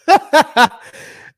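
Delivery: big, high burst of laughter; real loud laugh, falling off. Real, not acted. Laughing out loud mid-rant.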